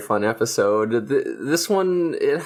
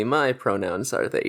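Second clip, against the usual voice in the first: usual speaking voice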